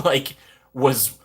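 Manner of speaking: ordinary talk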